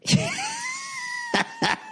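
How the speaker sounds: a burst of high laughter at his own line; big laugh. Real, not acted. Comedian cracking up at his own comeback, laughing. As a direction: crack up hard at the punchline.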